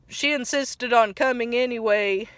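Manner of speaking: normal talking voice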